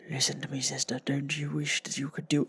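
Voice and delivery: low, whispered goblin voice; soft, sinister goblin whisper; creepy goblin. Retold or imitated. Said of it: "creepy, scary goblin man".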